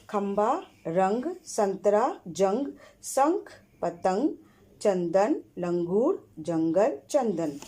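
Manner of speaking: each word spaced out, anusvara sounded clearly; word-list drill. Shown as a demonstration. Teacher reading a word list to pupils, clear and deliberate. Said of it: "पहले हम अनुसार वाला शब्द पढ़ रहे हैं".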